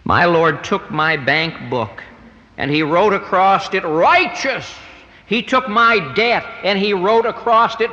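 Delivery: rising, ringing preacher's proclamation; sermon climax, voice lifted high and strong; preaching at full force. Acted. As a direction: build it up line by line from the pulpit. Preaching climax over the debt.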